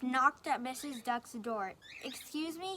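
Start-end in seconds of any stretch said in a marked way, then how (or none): 2.0-2.8 soft sweet call; sweetly. Acted. Wolf calling sweetly at the duck's door. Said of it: "he called sweetly".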